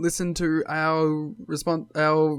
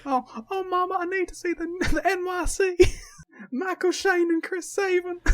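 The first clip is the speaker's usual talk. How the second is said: very high mock Southern pleading; Southern accent. Co-host joining the bit, begging Mama, Southern accent. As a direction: join in with a squeaky, giggly Southern voice. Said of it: "I've adopted the accent".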